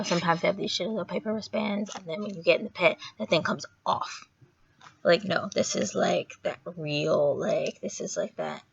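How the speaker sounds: ordinary talk, nothing marked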